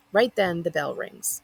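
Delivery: usual speaking voice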